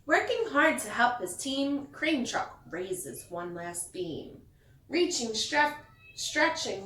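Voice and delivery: lively picture-book read-aloud; storyteller reading to kids; reading to children. A narrator's reading. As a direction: read it like bedtime story time.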